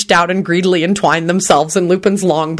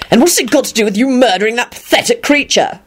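higher, indignant retort; snapping; 6 semitones higher than usual. A narrator's reading. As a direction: challenge him with mild anger. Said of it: "Lupin snapped back".

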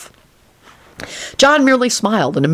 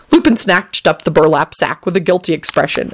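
ordinary delivery, nothing marked

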